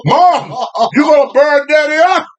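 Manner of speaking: put-on high-pitched child's cry of alarm; hammed-up panicked plea; mock alarmed yell. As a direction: yell at Mom in comic panic. Children plead as dad burns.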